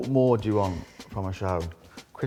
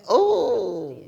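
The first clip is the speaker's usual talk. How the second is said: higher, louder crazed crone voice; old mad witch; 13 semitones higher, 6 dB louder than usual. Retold or imitated. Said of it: "No, old and mad".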